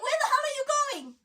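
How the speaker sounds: high, loud exasperated call after him; outraged yell. Retold or imitated. As the doctor leaves her, high outraged yell. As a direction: shout after him in disbelief. Doctor walks away mid-panic.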